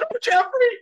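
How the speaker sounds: very high, loud sighing exclamation; swooning girlfriend. Retold or imitated. Girlfriend seeing Prabhupada for the first time, very high and loud. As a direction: gush his name like a smitten girlfriend.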